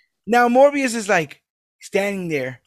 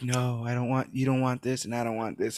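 low, quiet, flat-affect mumble; monotone mumble; 4 semitones lower, 7 dB quieter than usual. Retold or imitated. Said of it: "it's all just Michael being like".

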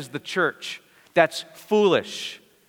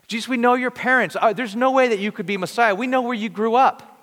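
scoffing; 6 semitones higher, 3 dB louder than usual. Retold or imitated. Townspeople doubting Jesus.

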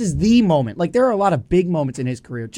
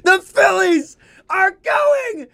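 hoarse, strained shouting after a big win; lost voice; 12 semitones higher, much slower than usual. Real, not acted. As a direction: voice shot from yelling all night. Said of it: "I have no voice".

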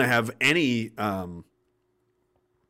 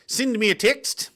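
Aussie lilt, higher and rising on "text"; Aussie accent; 7 semitones higher, slower than usual. Shown as a demonstration. Example for the Aussie lesson, Aussie accent. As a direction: try an Aussie "sind me a tixt". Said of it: "Aussie accent lesson one".

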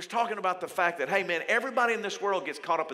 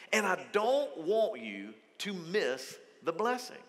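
tender plea; slower than usual. Acted. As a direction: draw the listener in gently, slowly. Jesus voiced pleading invitation.

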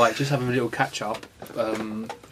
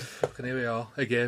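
weary, fed-up sigh of a line; exasperated; 3 semitones lower, 10 dB quieter than usual. Real, not acted. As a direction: sound worn out and exasperated. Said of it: "work on the exasperated tone".

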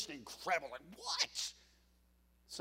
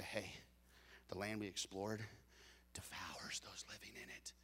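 low, hushed and secretive, much softer; gossipy whisper; 9 semitones lower, 12 dB quieter than usual. Acted. Spies whispering bad news in the car, gossipy whisper. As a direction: lean in and spread the rumor quietly.

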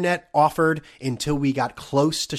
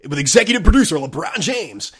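louder, higher mock announcer billing; 4 semitones higher, 7 dB louder than usual. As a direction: announce a big-name credit with mock grandeur. Mocks a show's producer credit announcement.

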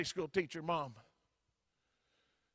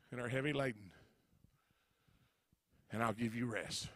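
gentle altar call; 4 semitones lower, 3 dB quieter than usual. Acted. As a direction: draw them in quietly with heads bowed. Altar call after the sermon.

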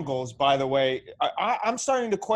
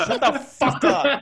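laughing yell; 10 semitones higher, 6 dB louder, slower than usual. Real, not acted. Playful insult among friends.

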